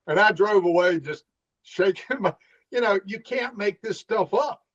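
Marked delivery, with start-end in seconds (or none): none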